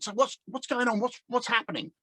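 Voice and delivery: a producer calling out across the stage; producer shouting. Retold or imitated. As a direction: yell it like an impatient producer. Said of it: "the producer shouted".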